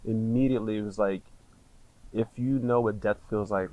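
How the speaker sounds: normal talking voice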